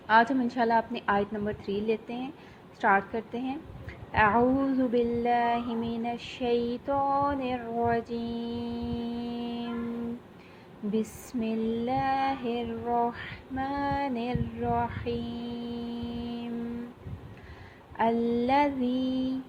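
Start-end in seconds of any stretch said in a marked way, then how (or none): 4.1-16.8 slow, drawn-out tajweed chant; measured Quranic recitation, long vowels. Shown as a demonstration. Teacher begins Quran recitation. 18.0-19.4 الذی drawn out, dhal soft; slow recited الذی, soft dh sound. Shown as a demonstration. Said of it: "زال کو نرمی سے ادا کریں گے".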